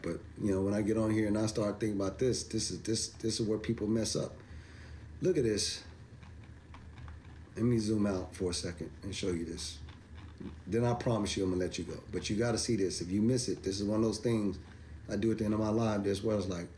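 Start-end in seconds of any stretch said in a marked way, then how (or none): none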